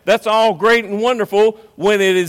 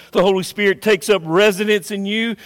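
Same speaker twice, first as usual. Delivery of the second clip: ordinary delivery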